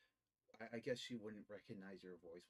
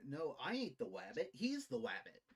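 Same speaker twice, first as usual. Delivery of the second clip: lightly voiced cartoon rabbit dodging blame; a hint of a cartoon trickster, a bit louder; Bugs Bunny; 4 semitones higher, 8 dB louder than usual. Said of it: "like Bugs Bunny able to tell Elmer Fudd".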